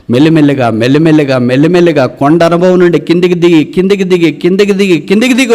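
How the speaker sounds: high, insistent chanted repetition; impassioned preaching. Real, not acted.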